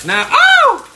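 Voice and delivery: high loud startled cry; sudden pain. Real, not acted. Cook cries out as oil hits his eye.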